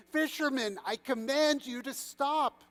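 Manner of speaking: high, anxious command; sincerely worried. A narrator's reading. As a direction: order him with the authority slipping away. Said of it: "that of a sincerely worried man".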